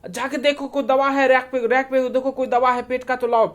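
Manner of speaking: high, loud urgent orders; yelling at husband. Acted. Wife yelling at her husband to fetch medicine, high and loud. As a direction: shout the orders in a panic. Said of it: "Pinky जोर से अनूप पे चिल्लाती है".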